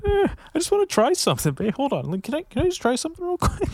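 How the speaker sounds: very high, fast wheedling voice; mock pleading. Pleading with his babe to try something, high wheedling. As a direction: beg sweetly like a pushy boyfriend.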